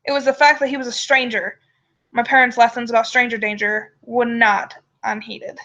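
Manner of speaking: usual speaking voice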